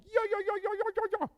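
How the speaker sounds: very high, rapid yipping call; animal call. Shown as a demonstration. Demonstrating the call for a sleeping cow, high yipping. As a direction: yelp out a repeated high-pitched call. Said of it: "give it a call like".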